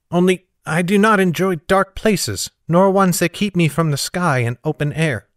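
a hint of a nervous young noblewoman; young girl Annis. A narrator's reading. Annis telling Jem she dislikes dark places, lightly voiced, uneasy.

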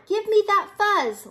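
high, insistent demand; mad. A narrator's reading. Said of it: "they were mad".

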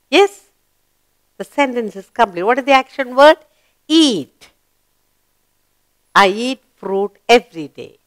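usual speaking voice

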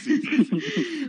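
laughing. Pretend. Laughing in put-on voice.

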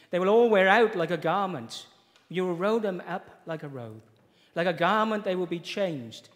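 formal public reading of scripture with emphasis. Acted. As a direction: read it out like a lesson from the lectern. Public Bible reading from Hebrews.

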